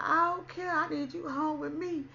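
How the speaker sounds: snapping at her man from hurt; emotional outburst. Retold or imitated. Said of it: "I was all in my feelings".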